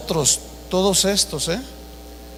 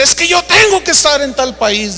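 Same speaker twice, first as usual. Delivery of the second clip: raised-pitch mock indignation; indignant insistence; 5 semitones higher than usual. Famous preacher argues with airline clerk, imitated indignant insistence. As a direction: insist like an entitled passenger.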